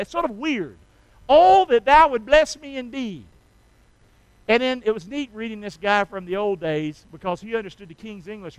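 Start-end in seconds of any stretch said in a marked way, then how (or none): 1.3-3.2 loud, raised-pitch prayer cry; fervent biblical prayer. Acted. Preacher repeating Jabez's prayer for the congregation, fervent pleading. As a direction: call out the petition to God with force. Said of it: "He starts off his prayer like that".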